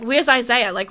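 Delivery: ordinary delivery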